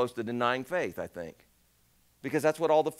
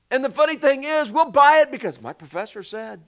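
high, loud fervent preaching; 10 semitones higher, 9 dB louder than usual. Acted. Preacher urging the congregation, rising fervor. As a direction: lift the room with urgency.

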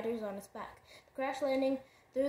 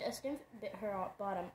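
plain reading voice

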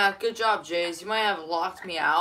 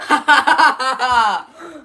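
big, high burst of laughter; loud laugh; 6 semitones higher, 12 dB louder than usual. Real, not acted. Cracking up at a friend in the game, loud laugh. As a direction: explode into a laugh.

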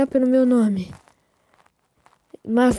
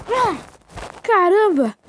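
excited; 6 semitones higher, 4 dB louder, much slower than usual. Real, not acted. Plane finally lands.

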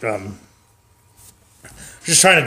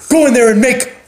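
higher, brusque order; bossy dad; 8 semitones higher, 4 dB louder than usual. Acted. Dad ordering kids to cook for his wife, bossy. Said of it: "he'll be like".

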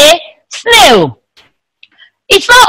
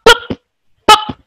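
short, high pops; cork pop; 9 semitones higher than usual. Shown as a demonstration. Teacher repeats the bottle cork's sound, popping. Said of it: "you hear the sound".

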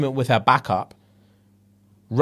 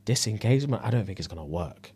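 retold whisper; 4 semitones lower, 9 dB quieter than usual. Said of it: "she whispered to him".

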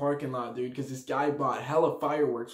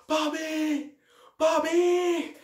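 high, drawn-out searching cry; hammed-up call through battle smoke; mock movie calling out; 12 semitones higher, much slower than usual. Movie survivors seek a comrade, mock calling out.

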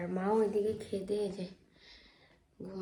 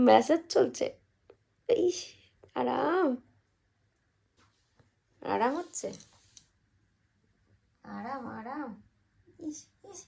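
cooing to a pet; 4 semitones higher, much slower than usual. Real, not acted. Cooing over a pet being massaged.

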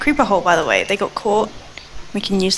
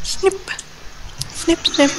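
scissor snips; 8 semitones higher, 4 dB quieter, slower than usual. Retold or imitated. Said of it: "I love the little snipping sound".

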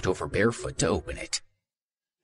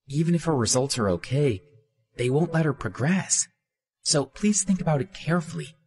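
higher, urgent friend; raised-pitch earnest warning; Hannah warning; 7 semitones higher than usual. A narrator's reading. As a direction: urge him to think it over.

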